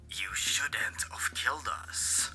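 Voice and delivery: soft, slow, breathy ghost whisper; ominous whisper. A narrator's reading. Ghostly caller on the phone haunting the boy, whispered slowly. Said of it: "A ominous voice spoke, just like Vison's voice".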